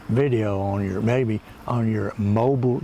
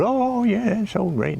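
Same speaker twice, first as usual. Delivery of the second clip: mock gushing; 10 semitones higher, slower than usual. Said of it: "everybody goes".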